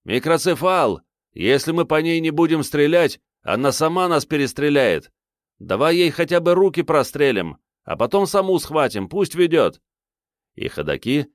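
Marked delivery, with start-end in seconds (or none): 0.0-9.8 high-pitched yell across a firefight; urgent shout. A narrator's reading. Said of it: "И кто-то из них крикнул".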